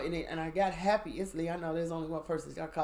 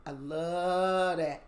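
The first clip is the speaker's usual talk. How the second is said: "I love that" drawn out slow and louder; savoring it; 4 dB louder, much slower than usual. Real, not acted. Savoring her love of knife combat, slow and louder. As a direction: relish every word of "I love that". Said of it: "Oh, I love that".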